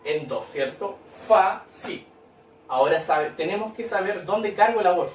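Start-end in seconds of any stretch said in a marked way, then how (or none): 1.3-2.1 "Fa, si" split into two separate syllables. Shown as a demonstration. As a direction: break "Fa, si" apart with a clear gap. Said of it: "Fácil se separa".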